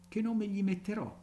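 high musing question to himself. A narrator's reading. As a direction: wonder aloud to yourself. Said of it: "disse fra sé e sé".

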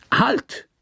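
sudden high call to stop; abrupt high shout. Acted. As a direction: yank him back with one word, pitched high. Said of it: "rief Terrell".

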